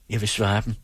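usual speaking voice

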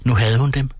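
usual speaking voice

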